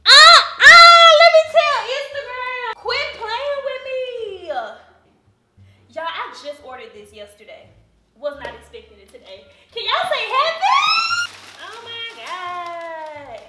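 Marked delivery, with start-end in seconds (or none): 0.0-4.5 high, loud delighted shouting; excited shout. Real, not acted. Shrieking over surprise package. 9.7-13.4 excited. Real, not acted. Still thrilled about shoes.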